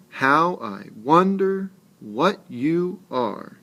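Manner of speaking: slow recitation, "Twinkle", "wonder", "above" swung high on the stressed syllable; metrical stress. Shown as a demonstration. Teacher reading the poem to students, slow and rhythmic. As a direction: lean on every other syllable from "Twinkle" to "sky". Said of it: "you heard the stressed and unstressed syllables".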